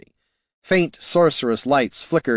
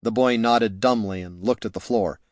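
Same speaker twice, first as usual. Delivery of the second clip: usual speaking voice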